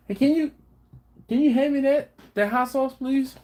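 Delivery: high, loud request to the next room; calling out. Real, not acted. Asking someone off camera for the hot sauce, loud call. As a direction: call for the bottle so they hear.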